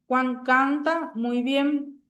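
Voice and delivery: "Juan canta muy bien" said slowly, word by word; slow example read. Shown as a demonstration. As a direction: spell out the example sentence slowly. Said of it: "En la oración". (lectura lenta y pausada)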